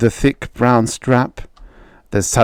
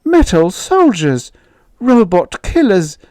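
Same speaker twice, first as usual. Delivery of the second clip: horrified mother; 10 semitones higher, 4 dB louder than usual. Acted.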